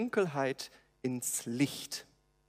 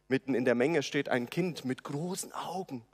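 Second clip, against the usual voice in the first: usual speaking voice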